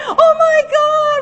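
high, loud cry of amazement; thrilled shout. Retold or imitated. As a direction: shout it in disbelieving joy. Family hears boy's first sound.

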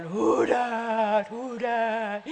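low, drawn-out mock phantom voice; teasing spooky voice. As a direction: tease with a slow, deep croak. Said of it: "He's teasing me".

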